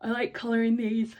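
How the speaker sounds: words stretched by a yawn. Real, not acted. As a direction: talk through a big yawn. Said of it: "I'm yawning".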